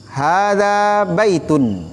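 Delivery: slow, drawn-out teacher model; careful slow demonstration; slow model reading. Shown as a demonstration. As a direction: say it slowly for the class to repeat. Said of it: "teacher models phrase slowly".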